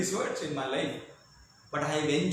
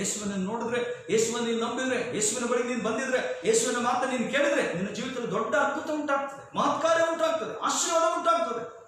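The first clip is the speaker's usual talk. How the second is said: building preaching crescendo; 6 semitones higher, 3 dB louder, much faster than usual. Acted. Preacher promising miracles to believers, building fervently. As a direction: pitch climbing phrase by phrase, fast and insistent.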